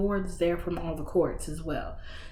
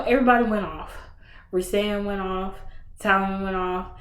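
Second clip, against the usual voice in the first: ordinary delivery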